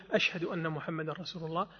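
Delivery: low voice. Shown as a demonstration. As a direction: murmur it quietly before raising it. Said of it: "ثم يرجع الشهادة الثانية". (بصوت منخفض هامس)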